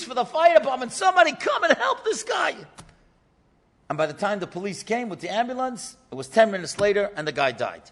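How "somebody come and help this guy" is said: retold yell for help. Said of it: "he's yelling for the police".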